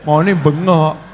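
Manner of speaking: usual speaking voice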